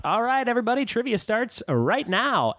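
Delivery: high, drawn-out trivia host call; imitating a quiz host's kickoff; retold host announcement. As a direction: announce it to the room, slowing at the end.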